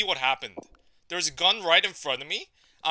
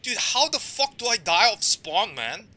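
loud, higher-pitched disbelieving rant; furious outburst; 5 semitones higher, 8 dB louder than usual. Real, not acted. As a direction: shout it at the game. Raging after dying again.

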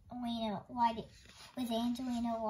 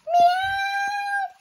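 very high, loud, long meow; howling cat meow; 14 dB louder than usual. A narrator's reading. Big Cat howling behind the children, piercing meow. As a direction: yowl like a cat on one held note. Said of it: "Something howled behind".

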